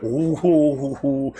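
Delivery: slow crowd "ooh"; string of long, awed oohs; imitated awed oohs. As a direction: imitate a crowd marvelling, drawn out. Crowd marvels at his English.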